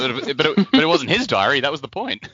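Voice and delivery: laughing objection. Real, not acted. Co-host correcting the other about the diary, laughing objection. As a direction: protest while cracking up.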